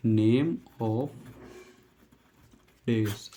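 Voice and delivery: name of days, broken by long pauses; word by word. Shown as a demonstration. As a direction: dictate "name of ... days" slowly. Teacher models phrase word by word.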